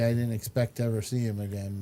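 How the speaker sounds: normal talking voice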